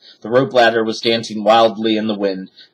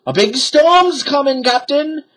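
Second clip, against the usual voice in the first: high pirate voice. A narrator's reading. Pirate warns captain of storm, high worried voice. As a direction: warn the captain nervously. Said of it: "said Pinky".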